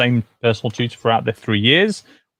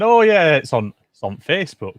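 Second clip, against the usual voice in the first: put-on Yorkshire accent; 5 semitones higher, 4 dB louder than usual. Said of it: "my Yorkshire accent comes out really strong".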